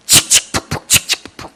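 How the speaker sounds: breathy whispered chug-chug of a train; soft imitated locomotive chuffing; train chugging noise. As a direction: puff out the train sound under your breath.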